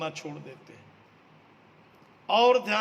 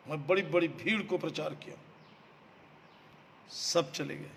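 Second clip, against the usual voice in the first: usual speaking voice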